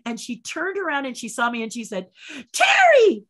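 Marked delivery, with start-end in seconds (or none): 2.5-3.2 very high, loud cry of greeting; delighted shriek. Retold or imitated. Actress turns and sees her friend, high loud shriek. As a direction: shriek the name in excited recognition.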